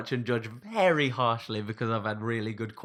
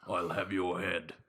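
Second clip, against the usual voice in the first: slow, lower put-on orc threat; 5 semitones lower, 4 dB quieter, slower than usual. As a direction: menace them like a hulking brute. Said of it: "come across and say".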